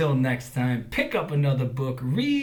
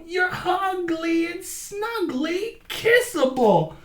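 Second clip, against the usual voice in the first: high affectionate crow voice; 6 dB louder, slower than usual. A narrator's reading. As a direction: coo it fondly. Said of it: "called the crow".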